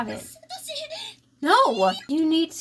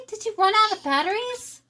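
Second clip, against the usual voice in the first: usual speaking voice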